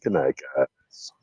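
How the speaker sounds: slow, soft whispered goodnight. Real, not acted. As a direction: whisper goodbye gently, slowly. Signing off the podcast.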